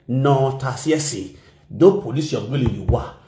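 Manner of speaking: normal talking voice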